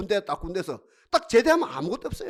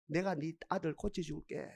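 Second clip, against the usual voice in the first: very soft, low reassurance; hushed, comforting pledge; tender divine promise; 6 semitones lower, 15 dB quieter than usual. Retold or imitated. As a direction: voice God's promise gently and quietly. Voicing God promising to heal.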